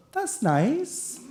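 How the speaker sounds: high, soft, doubtful lilt; loaded tone. Acted. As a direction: feign approval, quietly and high. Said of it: "you said 'that's nice' in a tone".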